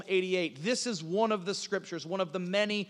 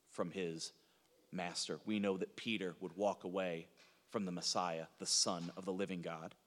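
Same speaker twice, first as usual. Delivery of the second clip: very soft, low and solemn; grave preaching; 12 semitones lower, 12 dB quieter than usual. Real, not acted. Preacher recalls Peter abandoning his Lord, hushed and grave. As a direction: hush right down, weighing each phrase.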